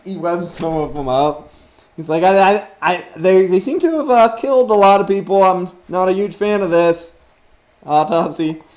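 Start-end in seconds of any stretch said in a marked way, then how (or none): 0.0-7.2 a hint of Spider-Man, higher and louder; nervous hero wondering aloud; lightly voiced Spider-Man. Spider-Man to his teammates, lightly voiced and uneasy. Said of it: "Spider-Man's like".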